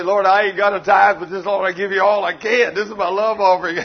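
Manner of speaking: loud pleading prayer to the Lord; a loud mock prayer; loud pretend prayer. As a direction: pray it out loud and honest. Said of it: "I'm either gonna say".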